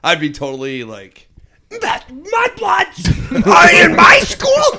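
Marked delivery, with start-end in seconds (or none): 1.7-4.8 high, loud, slow snooty shout; rich kid voice. Retold or imitated. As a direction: sneer it out like a spoiled heir. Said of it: "Sound like a rich kid".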